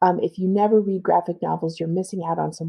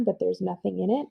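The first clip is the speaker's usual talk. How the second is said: usual speaking voice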